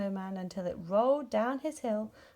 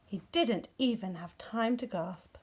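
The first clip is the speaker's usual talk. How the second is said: ordinary delivery, nothing marked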